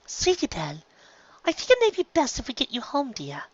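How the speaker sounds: tentative Rarity. Acted. Rarity tentatively suggesting her sister go home. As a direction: coax the little sister gently. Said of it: "she said tentatively".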